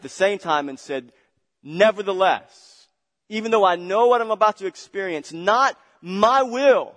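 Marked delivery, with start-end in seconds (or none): 1.6-5.3 full-force preaching. Acted. 5.3-6.9 higher, louder and slow: not my will; fervent cry. Acted. Same words preached loud.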